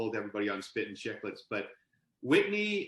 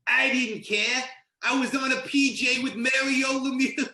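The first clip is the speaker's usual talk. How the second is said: put-on much higher, louder giddy young player voice; Ryan Whitney; 12 semitones higher, 5 dB louder than usual. Whitney telling how he sat in the jet bathroom, giddy and much higher. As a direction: squeal it out like a kid thrilled to be on the jet. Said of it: "And as he tells it".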